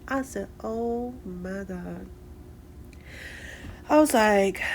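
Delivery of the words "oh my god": 1 soft dismayed. Retold or imitated. Hearing puppy bounced around.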